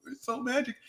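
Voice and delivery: soft, high, fast fake weepy gush; mock crying. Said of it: "So I'm gonna cry imaginary tears".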